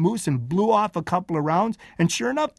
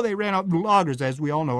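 ordinary delivery

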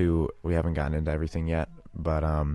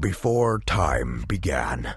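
Optimus Prime; 2 semitones lower, slower than usual. Acted. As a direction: intone it like a noble robot commander. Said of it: "I can do Optimus Prime from Transformers".

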